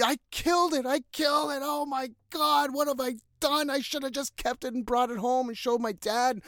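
high-pitched, imitating a panicked kid; mock panicked scream. Kid who killed the fish, imitated in a high panic. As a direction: wail as if you just ruined everything. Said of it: "He is screaming".

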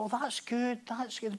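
soft, mumbled, half-hearted praise; nodding along without conviction; limp approval. Retold or imitated.

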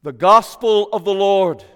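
loud, proclaimed to the nave; ringing acclamation. Real, not acted. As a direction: proclaim it to the whole church.

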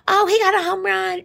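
high, excited mock fan girl; mock clueless fan. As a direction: squeal it like she knows nothing about football. Mocking clueless new fans.

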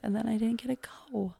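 sad. Real, not acted. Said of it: "sad about missing concert".

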